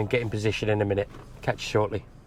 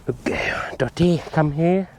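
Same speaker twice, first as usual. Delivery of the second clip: hushed whisper calling the dog in; 5 semitones higher, slower than usual. Real, not acted. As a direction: whisper so the birds aren't spooked. Calling dogs quietly on shoot.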